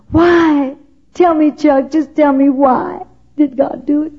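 mock anguished plea pitched high; retold anguished scream. Crying out to Chuck at the statue, a high mild mock scream. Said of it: "and I'd scream".

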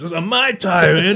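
loud, high New York holler; mock defensive New York shout; New York brogue. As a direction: imitate a New Yorker shouting back at his buddies. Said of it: "great New York brogue".

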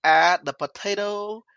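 slow mock Vietnamese accent cooking show; hammed-up Vietnamese accent, reading out a recipe. Podcast host doing a requested cooking bit in a mock Vietnamese accent. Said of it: "do it in the Vietnamese then".

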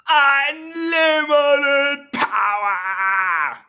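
very high, loud, drawn-out evil scream; zapping the kids, full evil emperor shriek; Palpatine shout. Retold or imitated. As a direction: stretch it into a crazed emperor's cry. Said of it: "I sound like Palpatine".

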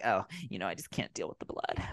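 soft, trailing-off imitation of a coward; mock wimpy whining. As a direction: fade into a near-whisper, mocking his squeamishness. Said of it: "little bitch mentality".

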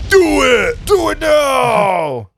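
high, frantic shout. Pretend. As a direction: yell it at the listeners, drawn out. Mock-urgent plea to listeners.

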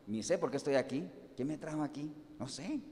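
a bewildered man mumbling to himself; lost and confused mumble. Retold or imitated. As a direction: sound lost, unsure why you're there. Simón voiced lost, confused.

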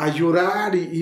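ordinary talk

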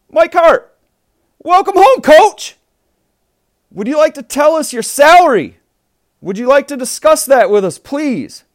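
loud, high eager interviewer; reporter voice. Acted. Mock press conference interviewer.

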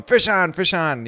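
high, loud calling out of a catch; retold yell. Friend yelling to everyone on the wall that a fish is on, high mock yell. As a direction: call it out like a hooked fish just hit. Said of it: "Nick starts yelling".

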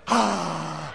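soft mock snoring sigh; low breathy snore sound; imitated snore. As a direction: imitate a dozing man's snore. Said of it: "making these sounds like that".

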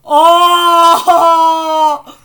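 loud, high scream; long shrieked "oh" of alarm; startled shriek. Real, not acted. Shriek during horseplay with lighter.

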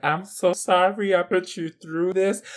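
slowed, drawn-out apology; lightly acted sorry drunk, taking its time; nice drunk. Imitating the co-host apologizing when drunk, nice drunk. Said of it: "the nice drunk where you're like".

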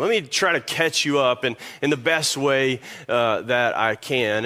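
ordinary talk, nothing marked

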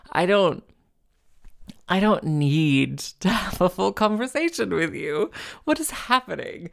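mock exasperation. Comic protesting a needless full conversation, exasperated. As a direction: plead in comic disbelief, pitch rising to the end. Acted exasperation at chatty callers.

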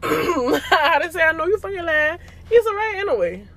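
high laughing denial; amused, high-pitched protest. Real, not acted. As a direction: laugh through the words.

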